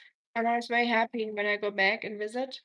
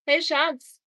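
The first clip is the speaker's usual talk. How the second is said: higher, louder call to a partner; raised-voice hail to get attention; 4 semitones higher, 6 dB louder than usual. Real, not acted. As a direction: call your sweetheart over from across the room. Calling partner over from stream.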